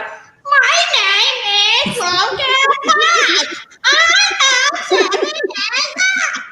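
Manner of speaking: put-on very high, drawn-out child with a confused foreign accent; hammed-up singsong kid's put-on British-American accent; mock phonics accent. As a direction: exaggerate a phonics-class accent, slowly and high-pitched. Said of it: "you find children talking like".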